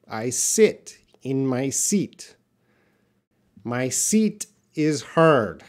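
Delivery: slow, clear "I sit in my seat" with short i then long ee; slow practice sentence. Shown as a demonstration. Teacher modelling a practice sentence slowly. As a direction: say it slowly, each word clear.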